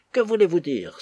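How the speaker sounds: narrated exclamation of indignation; indignant cry. A narrator's reading. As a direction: cut in with an indignant challenge. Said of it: "s'écria Lady Jane, dont la voix était émue par l'indignation".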